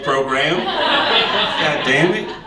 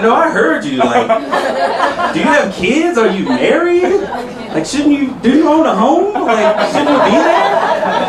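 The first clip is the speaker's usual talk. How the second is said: put-on higher, incredulous girl voice; mocking college girl; 9 semitones higher than usual. A college girl at the bar judging his age, high and incredulous. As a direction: scold like a judgy young woman. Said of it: "She said".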